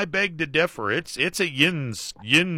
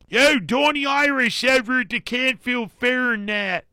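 high, exaggerated Pittsburgh accent; heavy Pittsburghese; 11 semitones higher than usual. Host imitating Pittsburgh locals in a heavy Pittsburgh accent. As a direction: ham up a Yinzer in a Pittsburgh accent. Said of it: "The heavy Pittsburghese".

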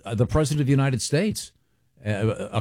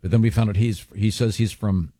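ordinary talk, nothing marked

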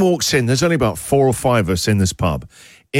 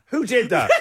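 mock angry demand; 9 semitones higher, 4 dB quieter than usual. Celebrity demanding culprit in pub.

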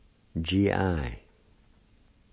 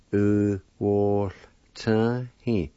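ordinary talk, nothing marked

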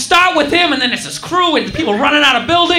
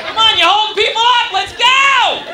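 conductor yelling; 4 semitones higher than usual. Retold or imitated.

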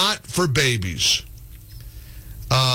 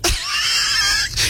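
high laugh. Real, not acted. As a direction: whoop of laughter. Host laughs at guest joke.

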